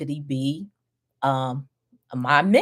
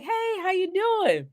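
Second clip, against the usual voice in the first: much higher, sweet phone voice; sugary phone greeting; 13 semitones higher than usual. Retold or imitated. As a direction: greet him brightly like a polite receptionist. Calls back the persistent caller.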